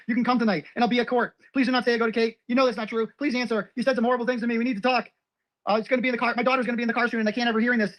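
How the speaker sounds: high monotone recital of texts; mock pleading read-out. Rattles off her pleading texts.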